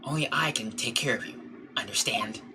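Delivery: soft, slow, ominous warning; quiet warning. Acted. Coffee server warning the visitors she is watching, quiet menace. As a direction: warn them in a hushed voice.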